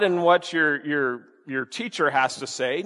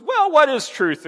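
high, louder throwaway question; dismissive Pilate; 10 semitones higher, 5 dB louder than usual. Retold or imitated. Pilate not waiting for Jesus's answer, mock dismissive. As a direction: imitate Pilate brushing it off. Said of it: "doesn't give Jesus a chance to respond".

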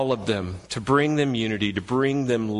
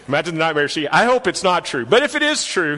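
ordinary talk

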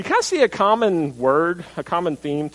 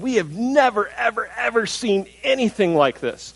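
awestruck crowd; 6 semitones higher than usual. Retold or imitated. Preacher voicing people glorifying God, awestruck. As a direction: marvel like the crowd did.

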